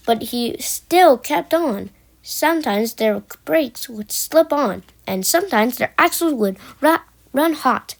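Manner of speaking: usual speaking voice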